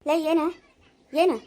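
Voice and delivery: sharp, loud demand; loud challenge. Acted. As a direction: fling it out as a dare. Sister snaps back in quarrel.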